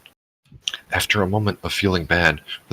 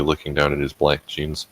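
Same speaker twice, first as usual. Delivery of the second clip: ordinary delivery, nothing marked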